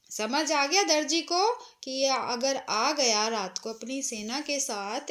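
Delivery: ordinary talk, nothing marked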